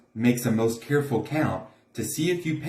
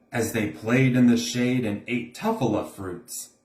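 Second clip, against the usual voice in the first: ordinary delivery, nothing marked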